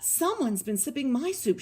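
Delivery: a touch of indignant growling; mild growl. A narrator's reading. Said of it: "she growled".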